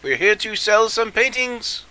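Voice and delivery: high, bright merchant hello; cheerful salesman greeting. Pretend. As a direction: sing out a cheery sales pitch. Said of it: "be like".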